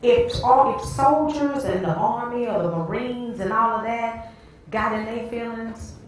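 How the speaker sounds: high-pitched preacher's argument, loud at the start; emphatic preaching. Real, not acted. Preacher warning about soldiers who got in their feelings, emphatic preaching. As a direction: launch hard into the illustration.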